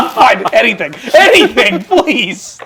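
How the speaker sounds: high loud begging through laughter; laughing desperate plea. Real, not acted. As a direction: beg while laughing hard.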